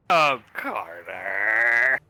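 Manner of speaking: low, long-drawn groaning name; drawn-out lament; exasperated groan. Acted. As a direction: stretch the name out in a slow groan. Acted groan at Carter.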